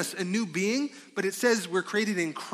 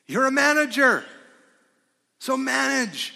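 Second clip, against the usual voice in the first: higher, louder emphatic declaration; preaching shout; 7 semitones higher, 7 dB louder than usual. Acted. Preacher telling listeners they are managers, loud and high. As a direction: drive it home to the congregation.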